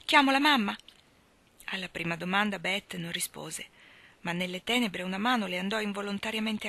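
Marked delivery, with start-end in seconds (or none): 0.1-0.8 higher, louder urgent offer; sudden worried rise in voice and volume; alarmed. Acted. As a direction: call out in alarm for a sick sister. Alarmed sister offers to call mother.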